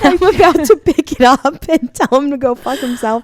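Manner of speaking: mock tough threat. To a friend, about answering the ex's call: loud mock threat. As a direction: act like you're about to tell him off. Telling friend she'll curse him out.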